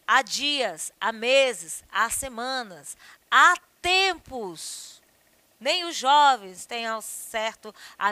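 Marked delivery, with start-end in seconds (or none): 3.3-5.0 "há" stretched very slowly and said loudly; teacher stretching "há tempos" for emphasis; drawn-out "há tempos". Shown as a demonstration. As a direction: drag out "há tempos" to mark the past.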